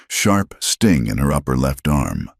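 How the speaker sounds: ordinary delivery, nothing marked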